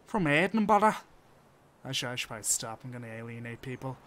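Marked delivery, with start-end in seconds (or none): 0.0-1.0 loud, raised-pitch mock Scottish; bad Scottish accent. Streamer claiming an Edinburgh origin, in mock Scottish. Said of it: "I can't do a Scottish accent".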